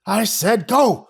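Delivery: put-on high, loud furious old man; old man yelling at rescuers; angry shout. A narrator's reading. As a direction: bark the order. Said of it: "Creepy Voice shouted angrily".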